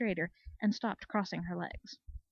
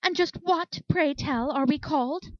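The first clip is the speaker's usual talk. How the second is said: higher and louder, icily offended; a lady demanding satisfaction; offended unicorn confronting the soldier mare; indignant demand; 6 semitones higher, 6 dB louder, slower than usual. A narrator's reading. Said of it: "the indignant mare advancing on her".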